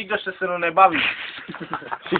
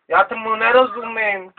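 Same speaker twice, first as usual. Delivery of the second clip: ordinary talk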